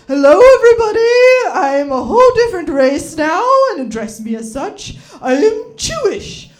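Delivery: hammed-up formal declaration to everybody; pompous announcement. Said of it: "the whitest thing ever to be like".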